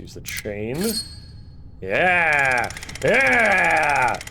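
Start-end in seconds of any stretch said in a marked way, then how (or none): 1.8-4.2 loud high whoop; excited cheer. Acted. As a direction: cheer it out big. Game character cheers.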